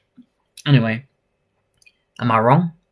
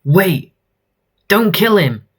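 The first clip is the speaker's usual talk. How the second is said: higher-pitched acted begging; urgent plea; 7 semitones higher, 4 dB louder than usual. Someone intervenes to save him, urgent plea. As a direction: cry out to stop a killing.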